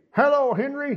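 high, put-on puppet-show greeting; a pastor playing ventriloquist for kids; pastor's ventriloquist voice. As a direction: greet the dummy in a squeaky showman voice. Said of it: "dummy named Henry".

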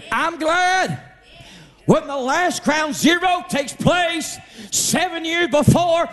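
high, shouting, jubilant sermon; preaching at full force. Acted. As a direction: shout it out like a cheerleader for God.

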